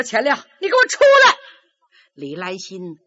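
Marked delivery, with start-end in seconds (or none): 0.0-1.4 loud, fast, high command; angry shout. Acted. Father yelling at his son behind the door, loud. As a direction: bark him out of the room.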